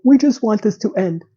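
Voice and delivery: lightly voiced emotionless drone; imitating a flat, emotionless apology; monotone. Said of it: "just her monotone tone".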